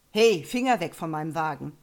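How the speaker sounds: wütend und laut geschrien; jähzornig. Acted. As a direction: die Frau lautstark anfahren. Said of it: "erregt und jähzornig attackierte". (jähzorniger Fahrer schreit die junge Frau an)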